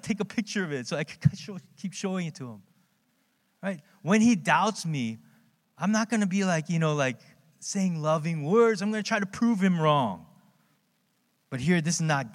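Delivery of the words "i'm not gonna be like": ordinary talk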